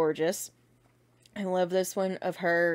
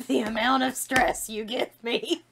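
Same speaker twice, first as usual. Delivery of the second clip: Winifred voice; 4 semitones higher than usual. Retold or imitated. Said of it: "I'm gonna read it in their voices".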